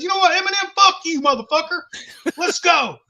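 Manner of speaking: high, loud re-enacted outburst; angry challenge. Drunk man challenging the mumbler to fight, angry yell. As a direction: call him out to fight.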